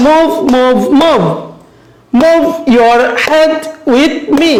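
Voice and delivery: "move", "head" and "me" hit as slow beats; slow, spaced words, punching "move", "head", "me"; stressed rhyme words. Shown as a demonstration. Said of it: "like this".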